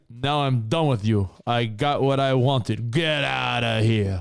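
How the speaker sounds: slow, cool dismissive macho voice; hammed-up cold tough guy; Razor Ramon impression. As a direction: brush her off like a cocky wrestler. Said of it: "And he's like".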